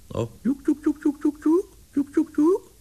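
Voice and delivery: quick, very high falsetto "joek" calls; repeated piping pigeon call; bird-luring call. A narrator's reading. Larry luring his bird down, piping falsetto calls. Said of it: "begon hij hem te lokken".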